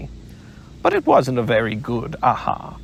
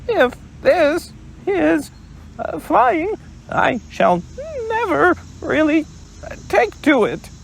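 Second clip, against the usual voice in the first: much higher, halting piglet squeak; jerky little Piglet. Acted. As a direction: stammer it out high like a jostled Piglet. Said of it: "he thought jerkily".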